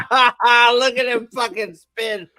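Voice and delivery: hammed-up delighted laughter; mock cackling glee. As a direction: ham up laughing at the spin. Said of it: "you're like".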